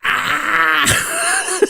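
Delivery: high startled yelp; scream. Retold or imitated. Waking from the nightmare with a high cry. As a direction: let out a high frightened cry. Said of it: "And I goes".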